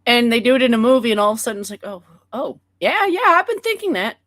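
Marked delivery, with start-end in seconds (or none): none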